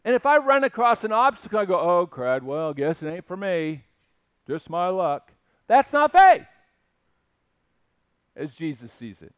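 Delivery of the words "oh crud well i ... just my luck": low, deflated mock resignation; mumbled defeat, acting out someone who quits; resigned giving up. Preacher voicing a quitter at an obstacle, resigned and low.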